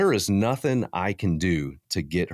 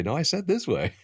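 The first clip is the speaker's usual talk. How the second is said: higher, sharper mock exasperation; exasperated spouse; 13 semitones higher than usual. A spouse guiding the camper back, exasperated. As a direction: repeat the order, losing patience.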